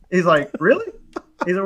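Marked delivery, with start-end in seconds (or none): 0.6-0.9 very high rising "really"; mock disbelief, voice jumping up and louder; incredulous cousin. Doubting cousin questioning the job.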